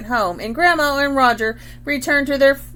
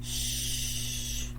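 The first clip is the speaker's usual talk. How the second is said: soft whispered shh; shushing; 14 dB quieter than usual. Acted. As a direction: hush someone to keep a secret. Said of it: "She's saying".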